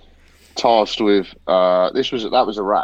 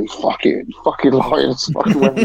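mock angry accusation, pitched up; half-joking yell of liar at a suspect; angry retort; 8 semitones higher than usual. Soldier catching a lying suspect, mock angry. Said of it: "We'd be like".

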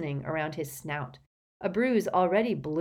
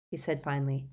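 ordinary delivery

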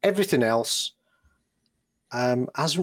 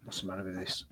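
patchy, cutting-out audio; tape dropouts; 5 semitones lower, 15 dB quieter, faster than usual. Real, not acted. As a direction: voice fading off-mic. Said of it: "your sound keeps cutting out".

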